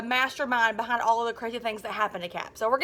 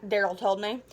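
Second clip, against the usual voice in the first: ordinary delivery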